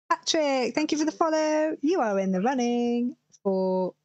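ordinary talk, nothing marked

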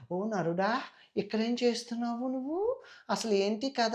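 sweet voice. Shown as a demonstration. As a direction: coo it sweetly to win him over. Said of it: "sweet వచ్చేస్తది".

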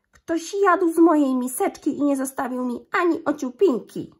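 little bear complaining in a high, loud voice; cieniutki głosik. A narrator's reading. (mały niedźwiadek skarży się wysoko i głośno)

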